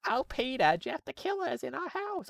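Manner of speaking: squeaky panicked housewife, badly done; Lois impression. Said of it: "I can't do a Lois voice".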